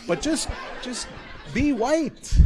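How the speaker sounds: laughing through the words; high giggly plea. Real, not acted.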